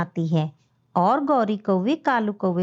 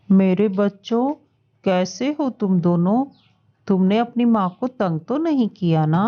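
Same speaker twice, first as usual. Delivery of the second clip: put-on a father greeting his kids; fatherly voice; 4 semitones lower than usual.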